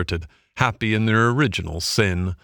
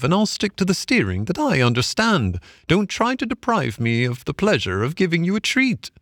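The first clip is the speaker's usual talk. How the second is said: high, swooping woman's voice; amused barge woman; 9 semitones higher than usual. A narrator's reading. Barge woman refusing to let Toad steer, high and teasing. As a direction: insist teasingly, pitched well up.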